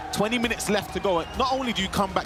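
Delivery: usual speaking voice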